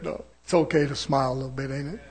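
ordinary talk

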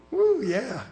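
high whooping "Woo"; excited whoop. Real, not acted. Preacher whooping at the congregation, excited. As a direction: let out a joyful whoop.

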